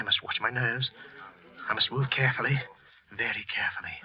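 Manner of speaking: hushed whisper to himself. Acted. Murderer warning himself among police, whispering. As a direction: breathe it, steadying his nerves. Killer steadying his nerves.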